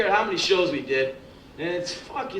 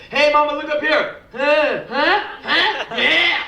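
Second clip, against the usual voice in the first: high, loud mock catcall, pushier on each huh; 10 semitones higher, 3 dB louder, slower than usual. Workers hassling a woman from above, hammed-up high holler. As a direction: hammer it like a leering guy on a scaffold. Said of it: "they always do this".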